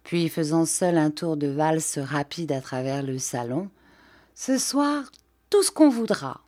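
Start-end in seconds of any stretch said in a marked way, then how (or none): 4.4-6.4 high, bright cry of joy; cried out. A narrator's reading. A girl waltzing alone cries out gleefully to the room. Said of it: "cria-t-elle".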